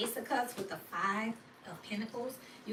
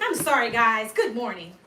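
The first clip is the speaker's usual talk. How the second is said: much louder, breezy mock-chipper greeting; fake cheerful apology; 12 dB louder than usual. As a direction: play the moody coworker breezing back in. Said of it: "come back and be like".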